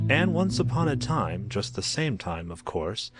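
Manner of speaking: ordinary delivery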